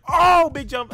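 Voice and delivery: pumped-up shout mid-jump; excited shout. Real, not acted. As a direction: yell it as you leap. Excited leap in game.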